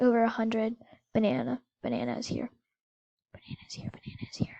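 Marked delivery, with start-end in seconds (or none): 1.8-4.6 soft, dropping into a whisper; hushed warning. Pretend. Player warning that Banana has arrived, hushed whisper. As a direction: repeat it under the breath, as if hiding.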